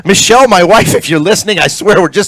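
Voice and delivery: high, loud, laughing-off denial; flustered mock protest. Real, not acted. Host swearing to his listening wife it's only lobster, flustered protest. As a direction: protest loudly, pitch jumping up.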